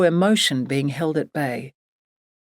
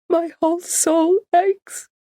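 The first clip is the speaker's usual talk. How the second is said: much higher and slow, aching; anguished; 13 semitones higher, slower than usual. Acted. Grieving Barbara pouring out her pain, anguished and slow. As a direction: let the grief pull the voice up. Grieving mother voiced by narrator.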